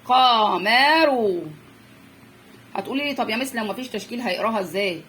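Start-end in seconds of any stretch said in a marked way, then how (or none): 0.0-1.4 قمر very slow, every vowel held; teacher's stretched reading of قمر; each vowel separate. Shown as a demonstration. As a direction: chant out قمر syllable by syllable. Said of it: "كل حركة لوحدها".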